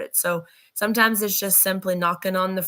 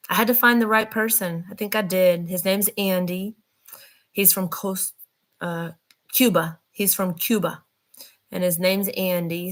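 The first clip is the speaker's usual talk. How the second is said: normal talking voice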